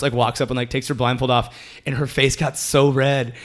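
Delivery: ordinary talk, nothing marked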